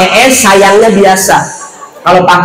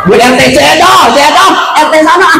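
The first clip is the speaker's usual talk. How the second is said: upset wife; 11 semitones higher than usual. Pretend. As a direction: shout it like a fed-up wife. Wife venting about neighbour.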